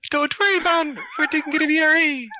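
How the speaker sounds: put-on high squeaky goblin with Jamaican accent. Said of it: "that was Gnomish and, and Jamaican".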